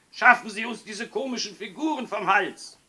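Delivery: high, loud indignant shouting; angry bellow. A narrator's reading. Fathers want snowmen gone, roaring at policeman. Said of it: "brüllten die Väter".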